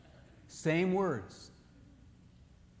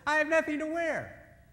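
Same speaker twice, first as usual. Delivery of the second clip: much higher woman's voice; woman among women; 13 semitones higher, 4 dB louder than usual. Retold or imitated. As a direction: say it like a woman to her friends. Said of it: "she says".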